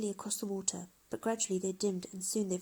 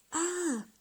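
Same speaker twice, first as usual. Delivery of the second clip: startled cry; 10 semitones higher, 3 dB louder than usual. Acted. Falls into barrel.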